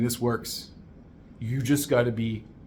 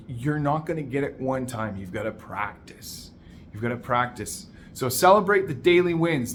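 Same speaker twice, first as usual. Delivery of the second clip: ordinary delivery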